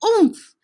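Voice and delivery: loud, high "oomph" of a sudden collision; bumping grunt. Acted. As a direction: knock the air out of him on impact. Moose trips and bumps into someone.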